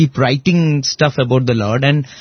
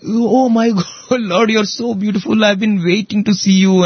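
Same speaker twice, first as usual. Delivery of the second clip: higher, awed and overjoyed; rapt devotee; 6 semitones higher than usual. Retold or imitated. Devotee seeing the Lord at Ratha Yatra, awed and high. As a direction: gasp it out in wonder at the Lord.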